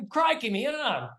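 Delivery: put-on high, excitable Australian accent; Steve Irwin. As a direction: do a broad Aussie wildlife-host impression. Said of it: "I used to do Steve Irwin".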